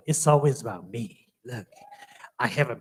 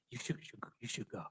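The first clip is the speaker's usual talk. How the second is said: put-on girlfriend's urging, whispered; hushed, insistent nudge to go; 4 semitones lower, 19 dB quieter, faster than usual. Girlfriend pushes him to audition, whispering.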